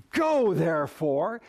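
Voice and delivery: slow, drawn-out "Go" before "therefore"; stress on Go. Shown as a demonstration. Preacher showing what some people emphasize, stretched "Go". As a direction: lean long on the word "Go". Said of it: "some people emphasize".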